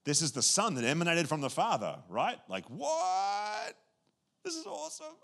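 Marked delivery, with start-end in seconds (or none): 2.5-5.1 high, hushed gasp of wonder; mock astonishment at the news; amazed. Preacher acting out amazement, high and hushed.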